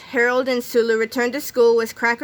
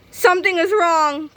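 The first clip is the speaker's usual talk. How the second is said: put-on higher and louder, alarmed kid; a scared boy crying out; panicked cry; 8 semitones higher, 4 dB louder than usual. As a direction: blurt it out in panic. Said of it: "cried Harold".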